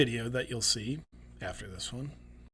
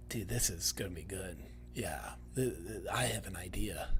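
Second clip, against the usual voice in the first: hushed, low, a hint of a plotting speechwriter; lightly voiced staffer whispering a sly idea; imagined speechwriter hatching a plan, soft and lowered; scheming speechwriter; 6 semitones lower, 11 dB quieter than usual. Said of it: "Just kinda like".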